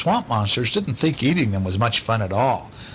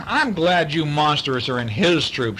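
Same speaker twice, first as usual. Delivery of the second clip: plain reading voice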